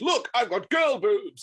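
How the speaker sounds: high, slow, gleeful cartoon exclamation; drawn-out mock-proud cry; Patrick Stewart. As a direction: show it off in a silly high voice. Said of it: "Patrick Stewart holds up two bum and goes".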